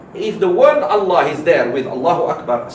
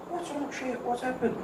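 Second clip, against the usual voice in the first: higher, softer, anxious question; worried students; 7 semitones higher, 12 dB quieter than usual. Retold or imitated. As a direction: ask gently like worried students. Students ask the crying sheikh.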